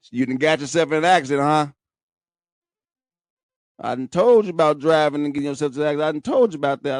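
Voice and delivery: lightly voiced uncle, fast and scolding. Uncle lecturing nephew about driving, lightly voiced fast scolding. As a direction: rattle off a mild uncle's lecture. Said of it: "He came dead in on Uncle shit".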